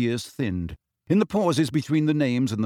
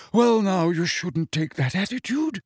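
gently scolding, a bit higher; reproving; 6 semitones higher than usual. A narrator's reading. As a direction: tut at him like a stern elder. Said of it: "said Nick reprovingly".

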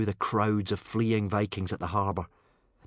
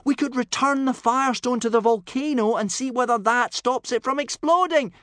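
put-on high, loud boy's voice pleading to be heard; urgent shouted appeal; 8 dB louder than usual. A narrator's reading. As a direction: call out urgently over the crowd. Pleading over a panicking crowd.

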